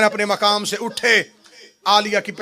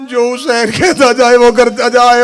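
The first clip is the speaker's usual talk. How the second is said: louder, higher, insistent repeat; emphatic command; 4 semitones higher, 9 dB louder than usual. Acted. Zainab commanding Abbas, louder emphatic repeat. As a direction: drive the order home with force. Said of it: "repeated louder".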